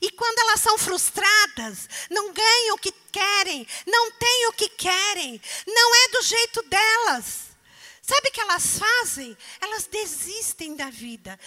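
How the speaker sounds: loud, high, urgent preaching. Acted. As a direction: drive the warning home. Preaching at full force. (pregação intensa e alta)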